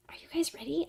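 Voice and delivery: breathy hushed tease to chat; soft whispered buildup; spooky whisper. Real, not acted. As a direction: whisper it like a ghost story. Teasing return to spooky stuff.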